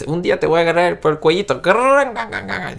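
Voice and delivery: high-pitched mock threat in Spanish; Ricky's Spanish threat. Fan acting out Ricky threatening to wring a neck, high mock threat. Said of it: "he says, he's".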